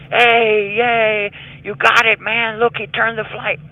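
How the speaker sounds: high, excited crowd cheer; cheering inmates. Retold or imitated. Inmates cheering as the plane turns, high whooping cheer. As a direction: cheer the pilot turning back like a gleeful crowd.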